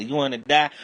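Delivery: high, loud threat; menacing shout. Retold or imitated. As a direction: shout it like a gunman. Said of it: "See how that dude say".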